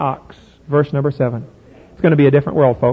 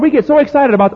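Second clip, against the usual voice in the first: ordinary talk, nothing marked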